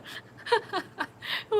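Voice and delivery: high breathy laugh. Real, not acted. Streamer laughing at the Sewer Jack typo, high. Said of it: "Me too".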